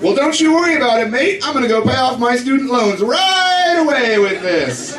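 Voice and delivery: fake-cheery sarcastic thanks. His sarcastic waiter reply.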